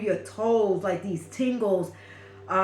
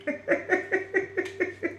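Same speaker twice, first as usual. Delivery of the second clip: high breathy laugh; 11 semitones higher, 4 dB quieter than usual. Real, not acted. Laughing at imagined slap.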